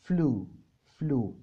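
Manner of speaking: فلو said slowly and clearly as flu; slow, careful teacher model of فلو; pronouncing flew. Shown as a demonstration. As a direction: spell out فلو slowly, like the English past tense flew. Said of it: "فلاي اللي هو فلو".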